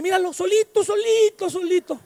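sing-song taunt, like a devil gloating; mocking devil. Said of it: "el diablo dice".

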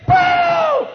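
very high, very loud bang; explosive boom. Retold or imitated. Preacher acting out the pressure cooker exploding, loud boom. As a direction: blast it out like the lid flying off.

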